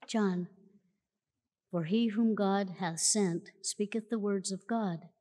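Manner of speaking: ordinary talk, nothing marked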